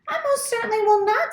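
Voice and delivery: high, fast indignant goose squawk; the old goose flatly refusing. A narrator's reading. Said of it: "squawked the goose".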